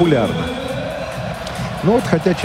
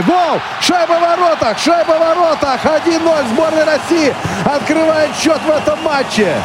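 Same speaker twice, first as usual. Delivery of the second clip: excited goal call; 9 semitones higher than usual. Real, not acted. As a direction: yell the goal with everything you have. Goal scored, commentator erupts. (ликующий крик «Гол!»)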